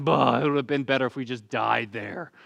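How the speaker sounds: grumbling whine; mock the moaning crowd; a lightly voiced grumble; whiny complaint.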